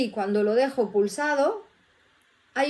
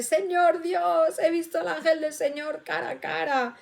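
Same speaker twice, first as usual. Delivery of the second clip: imitated cry out; 4 semitones higher than usual. Teacher retelling how Gideon cried to God, imitated cry out. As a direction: call out in awe, held back in volume. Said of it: "y gritó muy fuerte".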